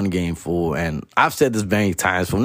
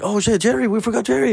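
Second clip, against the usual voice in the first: much higher mock friend voice, sudden realization; friend remembering; 13 semitones higher than usual. Friend realizing they forgot Jerry, high mock voice. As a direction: play the friend, high and startled. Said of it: "my friend would be like".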